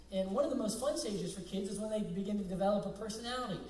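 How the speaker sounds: ordinary talk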